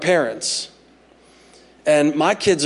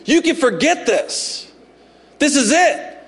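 mock proclamation; 10 semitones higher than usual. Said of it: "a proclamation to the family".

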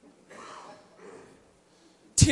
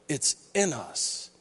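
ordinary talk, nothing marked

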